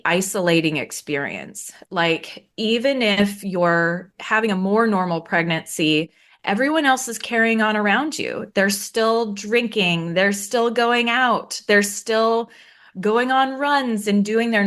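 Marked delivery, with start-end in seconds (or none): none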